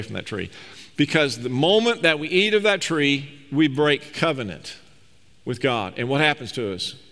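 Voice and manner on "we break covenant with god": ordinary delivery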